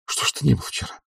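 high, soft female voice; hushed searching question; Olga's questioning. A narrator's reading. Olga questioning Oblomov.

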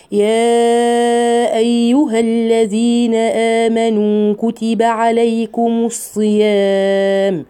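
slow chanted tajweed recitation; Quran recitation. Acted. Teacher recites the verse slowly for her students. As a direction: intone the verse slowly, every letter held.

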